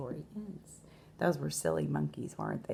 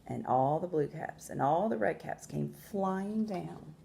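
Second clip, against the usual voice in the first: measured storytelling with long pauses; expressive picture-book narration; story read to children; 3 semitones higher than usual. A narrator's reading. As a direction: read aloud to young listeners, stressing key words.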